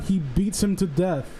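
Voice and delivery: ordinary talk, nothing marked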